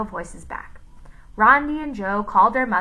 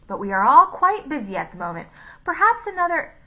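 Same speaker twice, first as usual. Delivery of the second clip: a light Scottish lilt, quick and firm; brisk Scottish brush-off; 7 semitones higher, 5 dB louder, faster than usual. A narrator's reading. Gentleman politely refuses the pie, in a light Scottish voice. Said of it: "in the Scottish's voice".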